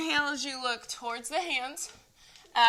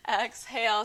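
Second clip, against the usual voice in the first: ordinary delivery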